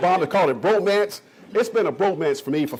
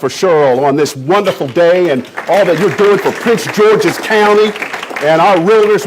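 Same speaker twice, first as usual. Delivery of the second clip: loud rallying call to the room; crowd hype shout; 11 dB louder than usual. Real, not acted. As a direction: pump up the audience. Emcee hyping up the crowd.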